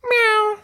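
high-pitched imitated meow; kitten's cry. A narrator's reading. Figaro tangled in puppet strings, meowing. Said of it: "cried Figaro".

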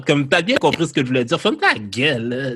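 ordinary talk

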